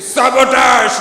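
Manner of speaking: loud, high menacing yell; menacing shout. Shown as a demonstration. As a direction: bellow it like a threat. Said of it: "in a menacing way".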